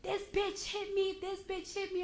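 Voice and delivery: high-pitched retold outcry; imitating someone wilding out. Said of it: "she started wilding out".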